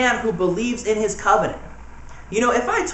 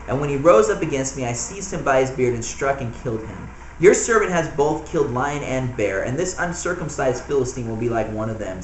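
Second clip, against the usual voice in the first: softer, steady Bible reading voice; scripture reading; 7 semitones lower, 4 dB quieter than usual. Acted. Pastor reading the passage to the church, softly and evenly. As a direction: read the verses gently and evenly.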